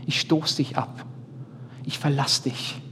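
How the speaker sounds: softly voiced rejection; quoted rejecting spouse. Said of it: "dass der Ehepartner gesagt hat".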